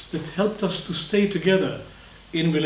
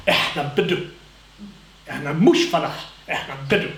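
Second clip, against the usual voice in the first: proud Bedouin; 2 semitones lower, much faster than usual. Retold or imitated. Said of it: "they have got this gesture".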